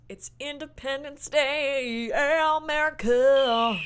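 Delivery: loud, high, drawn-out hype; mock patriotic shout. Host introduces the Fourth of July movie, mock patriotic shout. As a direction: holler it like a rowdy patriot, stretching the ends.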